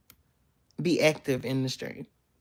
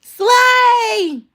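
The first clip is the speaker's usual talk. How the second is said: big cheer, full voice; high excited shout; 10 dB louder than usual. Real, not acted. Cheering a viewer's comment with a loud shout.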